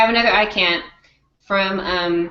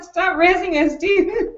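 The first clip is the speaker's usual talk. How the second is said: laughing quote; 9 semitones higher, slower than usual. Retold or imitated. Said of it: "he was like".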